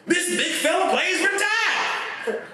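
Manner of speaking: very high, drawn-out panicked yell; paramedic's scream. Retold or imitated. Paramedic screams over the dying Alabama lineman, hammed-up scream. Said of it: "heard on this earth was paramedics scream".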